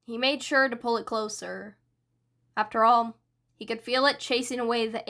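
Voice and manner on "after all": usual speaking voice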